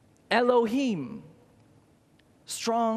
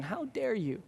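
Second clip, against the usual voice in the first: softer, pointed rebuke; hushed rebuke; 8 dB quieter than usual. Acted. Preacher rebuking doubting listeners, softer and pointed. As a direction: drop the voice and let it sting.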